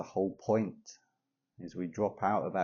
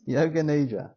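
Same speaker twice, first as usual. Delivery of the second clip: laughing at own slip; giggly recovery from a slip; amused; 7 semitones higher, 6 dB louder than usual. Real, not acted. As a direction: say it higher and louder through amusement.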